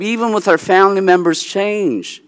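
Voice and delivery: ordinary talk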